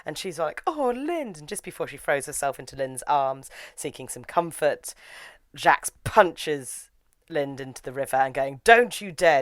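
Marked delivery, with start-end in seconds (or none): 0.7-1.4 high, drawn-out acted sigh of a name; mock swooning. Ladonia turns to Lind for comfort, high mock plaintive. Retold heroine seeking comfort. 8.7-9.4 louder, higher acted threat; mock angry warning. Jax punches Lind, loud mock angry warning.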